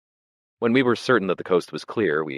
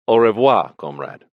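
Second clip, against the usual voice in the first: Russian farewell; 5 semitones higher, 9 dB louder than usual. A narrator's reading.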